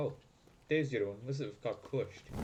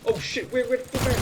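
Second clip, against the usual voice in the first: high, loud and startled; panicked cry at an unseen threat; alarmed yell; 8 semitones higher, 9 dB louder, faster than usual. Real, not acted. Player losing sight of the enemy, alarmed yell.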